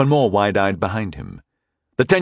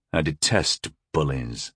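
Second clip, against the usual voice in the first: slow, soft and stern; stern and cold; severe; 7 semitones lower, 6 dB quieter than usual. A narrator's reading. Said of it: "I said severely".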